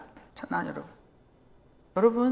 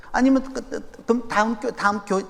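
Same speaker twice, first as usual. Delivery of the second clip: normal talking voice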